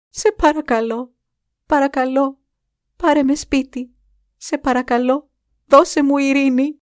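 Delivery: high, pleading cry; crying out prayers. A narrator's reading. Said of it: "η κραυγή των προσευχών της".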